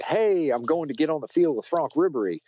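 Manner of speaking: high, eager pitch to the guard; pleading with the gate guard, high and eager; eager plea. Retold or imitated. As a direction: sell it with excitement. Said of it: "telling the guard at the gate".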